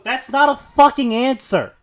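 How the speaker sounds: loud, emphatic scolding; indignant retort. Acted. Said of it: "I'll go".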